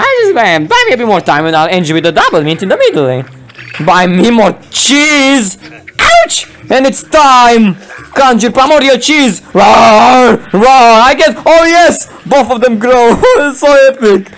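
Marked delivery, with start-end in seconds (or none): 3.8-14.3 drawn-out, hammed-up battle cries; overexcited. Gamer conjuring cheese and cheering growth, overexcited. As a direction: call it out like an epic triumph. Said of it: "You're way too overexcited right now".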